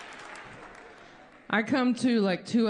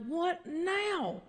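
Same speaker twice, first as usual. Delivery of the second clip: higher, softer, fed-up groan of a question; exasperated; 8 semitones higher, 5 dB quieter than usual. Retold or imitated. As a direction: sigh it out like you've had enough. Exasperated at restless crowd.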